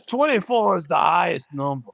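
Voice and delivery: lightly voiced dim character; a hint of a dopey skit voice. Friend quoting a favorite skit, lightly voiced dopey. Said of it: "My favorite skit is".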